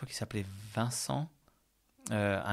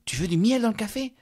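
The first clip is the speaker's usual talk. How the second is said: higher, louder pushy host voice; mock insistent offer, pitched up; imitating Momo; 10 semitones higher, 7 dB louder than usual. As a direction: press him to take honey, sweetly insistent.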